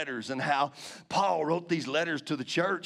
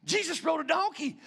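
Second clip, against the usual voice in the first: amazed; 10 semitones higher than usual. Retold or imitated. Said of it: "And I was like".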